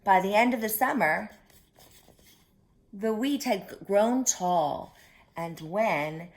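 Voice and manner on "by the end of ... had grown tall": ordinary delivery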